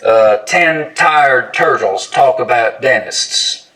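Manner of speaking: slow ten tired turtles with edge-focused T; tongue-tip tongue twister. Shown as a demonstration. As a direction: carefully tap each T in ten tired turtles. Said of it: "we just use the narrow edge".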